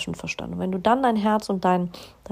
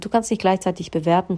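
ordinary talk

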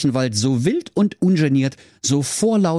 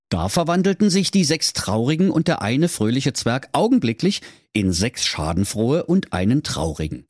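plain reading voice

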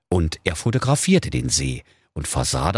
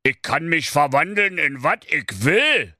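a hint of a gloating evil wizard, higher; wizard boasting; 9 semitones higher than usual. Evil wizard boasts to the dog, lightly sneering. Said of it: "lachte hämisch und rief".

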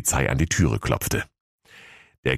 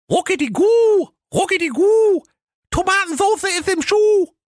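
tiny high-pitched chirping chant, swelling at line ends; twittering little cheeses; 4 dB louder than usual. A narrator's reading. Little cheeses warning the rider from the tree, shrieky high twitter. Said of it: "drei kleine Käslein auf dem Baume zwitschern".

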